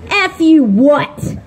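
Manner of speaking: slow, a hint of a stern coach daring him to repeat it; stern coach comeback. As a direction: drag it out like a warning. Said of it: "she gives him eyes and she goes".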